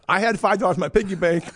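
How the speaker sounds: laughing through the words at the memory; a real laugh breaking into the line. Real, not acted. Laughing at his childhood story.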